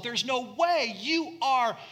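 high, loud, slowed insistence; Peter's anguished protest. Retold or imitated. Peter protesting to Jesus, high and loud. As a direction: plead it like a loyal friend refusing.

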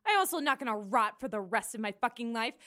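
ordinary talk

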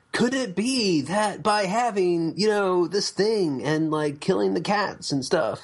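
high, slow, hammed-up documentary host. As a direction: ham up the earnest TV narrator. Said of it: "and the guy's like".

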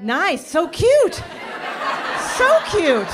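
high, loud delighted laughing squeal; giggly so cute; laughing gush. Real, not acted. Gushing over audience member's name.

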